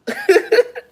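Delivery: short high burst of laughter; a sudden high laugh. Real, not acted. Laughing at being handed a Bible, high burst.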